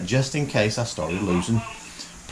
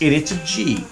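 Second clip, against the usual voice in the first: ordinary delivery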